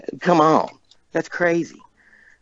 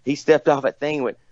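usual speaking voice

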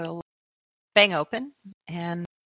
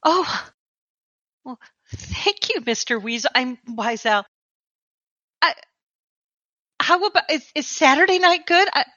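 excited; 6 semitones higher than usual. Acted. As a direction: gush with barely contained delight. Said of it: "she's so excited".

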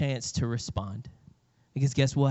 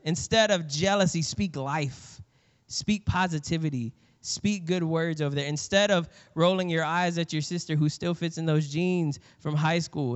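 ordinary talk, nothing marked

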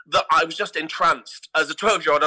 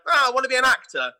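quick, higher, starry-eyed student voice; eager young self; 8 semitones higher, faster than usual. Retold or imitated. Student recalling his dream of acting, eager and high. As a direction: gush it like a keen drama student. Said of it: "I was like".

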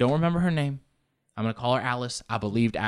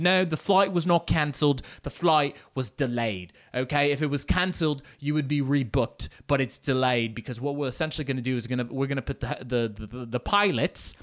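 put-on insistent British airline agent, amateurish accent; hammed-up British woman sticking to her story; bad British accent. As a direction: put on a shaky-quality British accent, explaining firmly. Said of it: "there's this British woman back there. I'm very bad with accents".

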